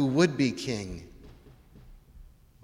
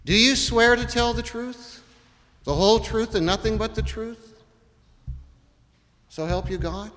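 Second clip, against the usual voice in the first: court oath; 7 semitones higher than usual. Shown as a demonstration.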